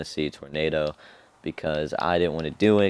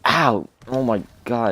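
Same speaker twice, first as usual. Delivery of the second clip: pained yelp; 5 semitones higher, 5 dB louder than usual. Retold or imitated. Hitting groin on turnstile.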